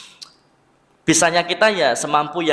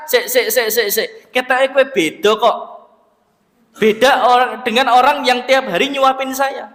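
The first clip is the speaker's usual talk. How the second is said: put-on higher, suspicious old beggar pressing the stranger; beggar's voice; 8 semitones higher, 3 dB louder than usual. Blind beggar tells Abu Bakar he is a different man, in a higher questioning voice. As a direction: pitch it up as the blind beggar who senses something is off.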